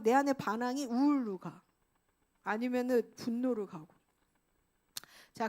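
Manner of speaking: normal talking voice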